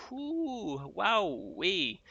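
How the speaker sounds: high excited whoops. Real, not acted. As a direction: whoop with delight at the winds. Reacting to extreme storm winds.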